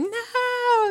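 high pleading cry of no; wailing no. Retold or imitated. Daughters-in-law refusing Naomi: high wailing protest. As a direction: cry out no as they refuse to leave. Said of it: "they were scream".

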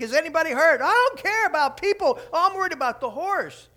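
high, loud retold outburst; hammed-up call about the horse; mock shout. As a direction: yell it like the worried lady. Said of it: "The lady's like".

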